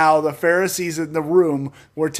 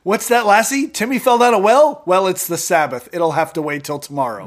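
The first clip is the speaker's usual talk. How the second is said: mock alarm dropping to dry refusal; sarcastic; 2 semitones higher, 4 dB louder than usual. Podcaster mimicking Lassie's owner on the Sabbath, sarcastic. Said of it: "I love this sarcastic Jesus".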